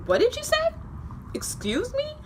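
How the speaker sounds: very high-pitched, affronted teacher voice. Retold or imitated. Offended teacher challenges booing student, imitated in high-pitched voice. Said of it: "that's why I sound like that".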